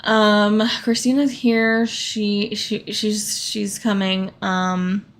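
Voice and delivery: ordinary delivery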